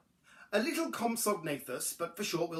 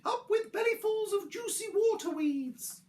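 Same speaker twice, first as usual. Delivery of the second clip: very high duckbill hoot; high dinosaur cheer. A narrator's reading. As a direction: hoot the chant far above your usual voice. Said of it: "they hooted".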